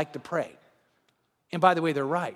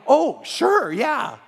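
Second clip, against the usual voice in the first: mock eager reply; 4 semitones higher, 5 dB louder, much slower than usual. Mocking Christians' awkward reply.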